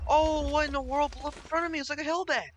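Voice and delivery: real startled cry; startled shout. Real, not acted. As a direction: yell as something blows up in your face. Mob explodes in front of him.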